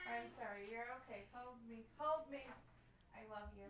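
very soft and tender; soothing parent. Real, not acted. Comforting hurt child.

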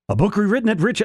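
reaching for the right Rick pitch; retry of Rick voice. Pretend. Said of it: "should be".

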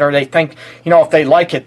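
ordinary talk, nothing marked

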